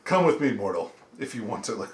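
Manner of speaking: ominous voice, fading at the end; lightly voiced menacing reaper; grim reaper voice. Voicing a salamander marine.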